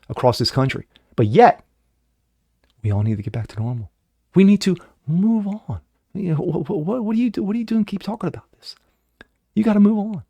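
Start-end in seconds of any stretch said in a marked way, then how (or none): 4.4-10.2 much higher, whiny mock scolding. Host parodies critics telling him to move on, higher and whiny. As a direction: parody someone telling you to drop it. Mocking those who say move on.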